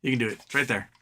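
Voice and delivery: ordinary delivery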